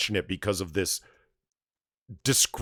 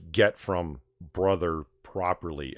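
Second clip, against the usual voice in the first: normal talking voice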